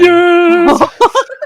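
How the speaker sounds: loud, very high gasp of delight. Real, not acted. Player reacts to a nat twenty, delighted gasp. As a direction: gasp sharply at the lucky roll. Reacting to a great comeback roll.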